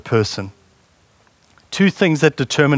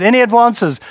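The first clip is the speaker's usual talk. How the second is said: auctioneer voice; 7 semitones higher than usual. Pretend. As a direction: call for bids like an auctioneer. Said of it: "sound like an auctioneer".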